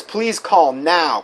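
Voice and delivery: slow, with NOW hit hard; stressed now. Retold or imitated. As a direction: draw out "Please call now" and lean on now. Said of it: "capital N O W".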